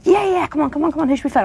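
high-pitched, excited egging-on; eager urging. Retold or imitated. Actress urging her coach into a prank call, excitedly. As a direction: talk the hesitant one into mischief.

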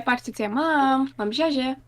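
usual speaking voice

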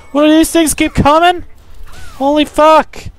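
exasperated shock. Real, not acted. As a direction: shriek at the endless swarm. More bugs keep coming.

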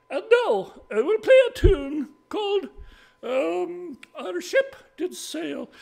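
high-pitched lady presenting her tune; announcing lady. Acted. Lady tells the audience what she will play, high-pitched announcement.

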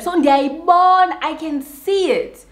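high and slowed; fed-up emphasis; exasperated insistence. Real, not acted. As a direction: drag out the point. Insisting she sees her mirror.

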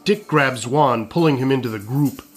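ordinary talk

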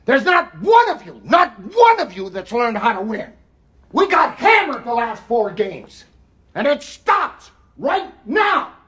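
shouting coach; halftime pep speech. Real, not acted. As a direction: yell it at the players.

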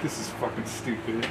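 very soft muttered complaint; under-breath mutter. Retold or imitated. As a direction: grumble it quietly to yourself. Recruit muttering head down.

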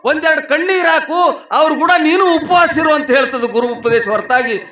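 high, loud exhorting sermon voice; full-voiced preaching. Real, not acted. Preacher urging sympathy for mourning families, full-voiced preaching. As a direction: drive each phrase out to the congregation.

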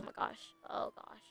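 breathless whisper; barely a whisper of dread. Real, not acted. Bracing for pit maneuver.

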